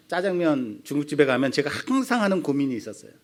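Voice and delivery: usual speaking voice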